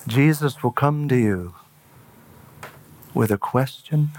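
ordinary talk

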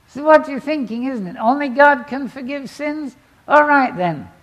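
loud, high and emphatic challenge; Jesus confronting. Retold or imitated. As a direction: call out the doubters with authority.

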